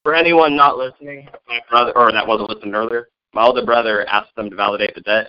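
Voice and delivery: normal talking voice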